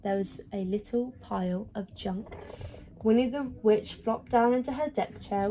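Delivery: ordinary delivery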